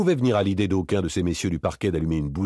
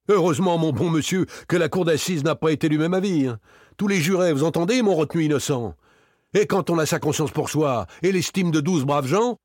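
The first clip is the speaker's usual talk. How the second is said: high-pitched indignant bluster; high, insistent protest; blustering self-defence; 10 semitones higher, 4 dB louder than usual. A narrator's reading. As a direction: argue it hotly, voice well up. Acquitted man defends himself indignantly.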